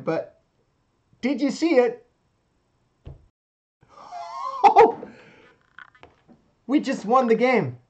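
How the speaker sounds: high, loud, shrieking with joy; shrieking jackpot winner. Real, not acted. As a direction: squeal like you just won big. Just won the board game.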